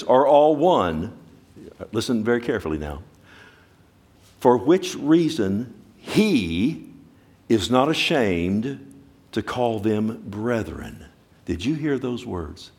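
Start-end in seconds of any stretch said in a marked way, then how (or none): 0.0-1.1 emphatic proclamation. Acted. Scripture climax. 4.4-11.0 slow, weighty scripture reading, every phrase held; slow solemn reading. Acted.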